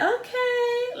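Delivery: very high, sing-out pleased okay; mock pleased squeal, held high; happy, smiling. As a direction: imitate a delighted mother with a smile. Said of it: "Like, she was smiling. Like, she was happy".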